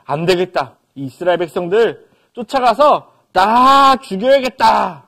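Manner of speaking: loud, furious threat; angry king. Acted. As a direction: shout the vow to kill them all. Pharaoh decides to kill.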